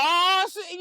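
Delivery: high mock outrage; riled reaction. Comedian imitating a celebrity provoked by an insult, riled.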